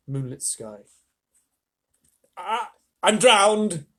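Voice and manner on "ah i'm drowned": put-on loud, very slow gasp of an old grasshopper; old grasshopper gasping, water-logged. A narrator's reading. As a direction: splutter it out half-drowned. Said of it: "gasped the old green grasshopper".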